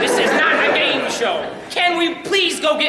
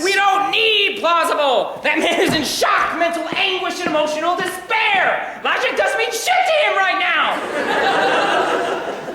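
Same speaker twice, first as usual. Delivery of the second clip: half-laughing shouts, pitch climbing higher; exasperated shouting; 4 semitones higher, slower than usual. Acted. Defending his wild story to the group, shouting with a laugh. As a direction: yell it like it's obvious, laughing through it.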